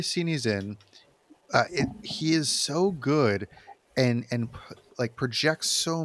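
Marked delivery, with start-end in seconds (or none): none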